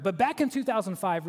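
usual speaking voice

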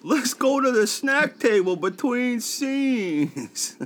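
high, slow excited Sandler voice; hammy high Sandler yelp; Uncut Gems Sandler. Acted. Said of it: "Do uncut gems".

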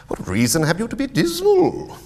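high, loud playful challenge; cheerful retort. Acted. Nephew turns uncle's question back on him, cheerful. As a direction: throw the question back high, rising toward "dismal".

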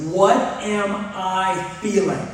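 slowed down. Retold or imitated. Angry husband checking his own emotions, slowly and deliberately. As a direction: space out every word as you question yourself. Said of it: "Let's go slow".

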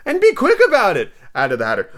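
high, loud, theatrical order; hammed-up bossy eccentric; impatient Hatter. Hatter voiced in story.

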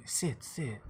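soft fortune teller voice; lightly voiced old woman seating a guest; mock mystical. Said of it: "tells you".

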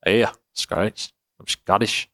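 rough attempt at a Scottish accent. Shown as a demonstration. Showing off a shaky Scottish accent attempt to a co-host, Scottish accent. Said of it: "Do your Scottish accent".

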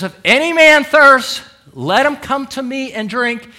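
Jesus calling out at the feast, high and loud; crying out. Acted. Preacher voicing Jesus at the feast, crying out. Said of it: "he cries".

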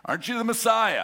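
mocking question, pitch pushed up; sarcastic. A thief on the cross taunting Jesus, sarcastic. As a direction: sneer it at him like a taunt. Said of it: "little sarcasm".

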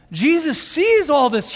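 usual speaking voice

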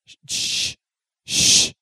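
hard, hissed shush; mock parental shushing; urgent shushing. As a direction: shush someone who is too loud. Mom shushing him in story.

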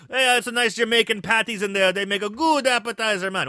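put-on hammy Jamaican accent, high and loud; bad Jamaican accent. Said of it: "Very bad Jamaican accent".

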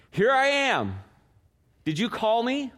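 high-pitched, eager reply to a summons; eager boy. Acted. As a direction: answer high, rising into the question. Boy Samuel answers again, acted.

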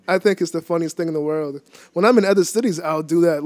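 ordinary delivery

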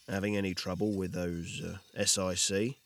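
low, unhurried question from the hero; low gruff voice. Acted. Victor asks quietly.

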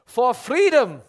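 loud, high mock-Scottish battle cry; hammed-up Scottish rallying cry; Braveheart Scottish accent. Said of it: "read with, like, a Braveheart".

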